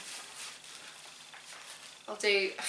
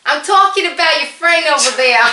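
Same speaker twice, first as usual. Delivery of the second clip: loud, raised, hammed-up New York accent; attempted New York accent; 7 semitones higher, 13 dB louder than usual. Player confronts Kimberly about her friend, hammed-up New York accent. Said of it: "I'll attempt New York".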